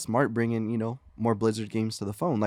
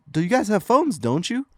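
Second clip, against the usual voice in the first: higher, louder baffled retort; lightly acted indignant appeal, pitched up; mock defensive presenter; 10 semitones higher, 5 dB louder than usual. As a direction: imitate a speaker pleading with hecklers. Mimics presenter answering the booing crowd.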